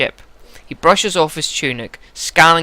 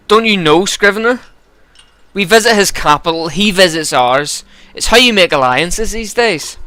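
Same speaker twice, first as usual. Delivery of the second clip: put-on gruff Ulster accent, raised pitch; Vognir; 6 semitones higher, 5 dB louder than usual. Giant explaining alliances to Scrivener, gruff Ulster accent. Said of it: "give him a bit of a gruff Ulster accent".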